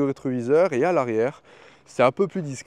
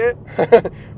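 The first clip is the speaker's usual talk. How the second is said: a loud real thrilled laugh; laughing after a rocket launch; excited laugh; 7 semitones higher, 8 dB louder than usual. Real, not acted.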